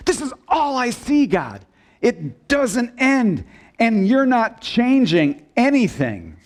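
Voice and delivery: high, exasperated plea to God; Habakkuk's complaint. Acted. Preacher voices the prophet protesting to God, exasperated complaint. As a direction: protest upward, leaning hard on key words. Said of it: "and he talks like this".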